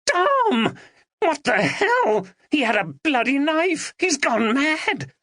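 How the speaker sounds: high, slow panicked cries; the friends scream after the stabbing; panicked shouting. A narrator's reading. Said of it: "now screamed and shouted".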